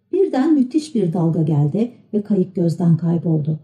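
ordinary delivery, nothing marked

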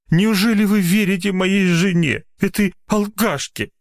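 high-pitched pitiful appeal; tragic. A narrator's reading. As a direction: plead as the wronged victim. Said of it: "трагическим голосом, пытаясь разжалобить".

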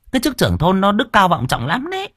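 mock his lofty title; sarcastic. A narrator's reading. Wife mocking husband's village chief pride. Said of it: "Bà Hường bĩu môi".

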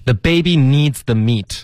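slow, clear model reading; measured, separated words; slow demo. Shown as a demonstration. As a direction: read it slowly for learners. Teacher models the line slowly.

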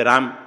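राम as one syllable, raam; final vowel dropped. Shown as a demonstration. Teacher shows the wrong way, राम ending on m. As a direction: end राम on a closed m, no vowel after. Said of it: "कोई कोई बोलते".

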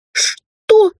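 very high, loud indignant cry; outraged gasp. A narrator's reading. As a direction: choke out a shocked what. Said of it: "задохнулась она от возмущения".

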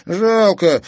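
put-on high boyish regretful cry; boy refusing to give up; boyish protest. Kazik objecting.

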